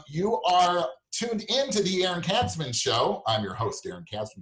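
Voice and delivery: slow, high, drawn-out show intro; radio announcer. Real, not acted. Host greeting his listeners with a slow announcer read.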